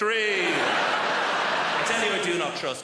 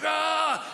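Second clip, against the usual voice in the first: despairing cry; 9 semitones higher, 3 dB louder than usual. Retold or imitated.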